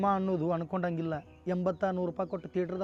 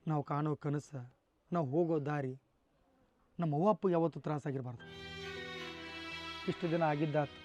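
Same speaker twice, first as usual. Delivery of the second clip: gentle advice; 4 semitones lower, 7 dB quieter than usual. Acted. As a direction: ease into tender guidance. Mentor gently advises young man.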